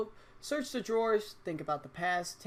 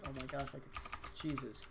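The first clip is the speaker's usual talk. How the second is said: ordinary delivery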